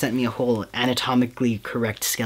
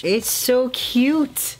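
very high, drawn-out coo; delighted gushing; 13 semitones higher, 3 dB louder, much slower than usual. Real, not acted. Vlogger unwrapping a cute present, delighted high gushing. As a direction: squeal it slowly with delight.

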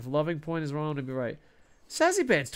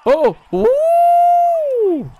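very high, loud cheering whoop; a real burst of joy at the trade; weird excited whoop; 15 dB louder than usual. Real, not acted. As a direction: let out a wild victory woo. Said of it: "such weird, annoying noises".

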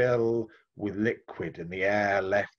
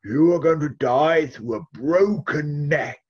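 put-on a higher, louder old man's pronouncement; old wise man; 4 semitones higher, 5 dB louder, slower than usual. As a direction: deliver the prophecy as the old man. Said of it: "the old man once again stroked his beard and he said".

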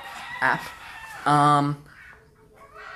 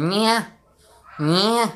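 imitating playback; 6 semitones higher, 3 dB louder than usual. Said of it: "It just sounds like I'm saying".

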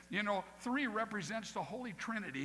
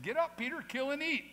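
booming voice of God; 9 semitones higher, 6 dB louder than usual. Acted. Voice of God commands Peter.

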